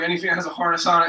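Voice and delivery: ordinary talk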